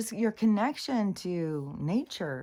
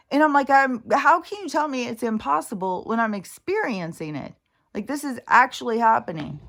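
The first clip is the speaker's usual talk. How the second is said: louder, indignant venting; heated rant; 3 semitones higher, 6 dB louder than usual. Real, not acted. Vlogger vents about the hairdresser, loud and indignant. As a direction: push back hard, exasperated.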